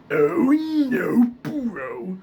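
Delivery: loud, high, forced gravelly cry; Muscle Man. Shown as a demonstration. Showing viewers the target sound, forceful Muscle Man voice. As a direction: push the gravel out hard. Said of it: "Muscle Man, you kinda wanna sound like".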